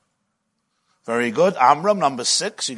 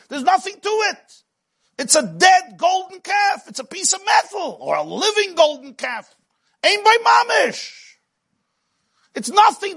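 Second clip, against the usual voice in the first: God scoffing; 13 semitones higher than usual. Acted. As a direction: scoff the calf down as worthless. God dismissing the golden calf.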